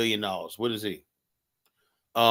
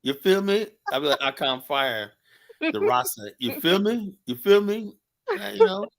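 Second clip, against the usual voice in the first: playful put-on Jamaican patois; lightly voiced Jamaican accent, high; 4 semitones higher than usual. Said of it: "Let me hear your Jamaican accent".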